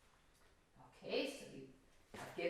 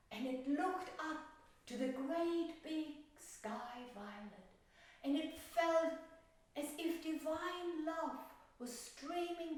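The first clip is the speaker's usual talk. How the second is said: tender story narration; 7 semitones higher than usual. A narrator's reading. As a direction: let the ending glow gently. Teacher tells the violet story.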